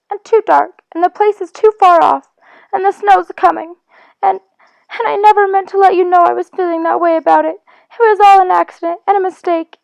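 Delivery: the upset little mole, pleading through tears; distraught mole. Acted. Mole's tearful voice held.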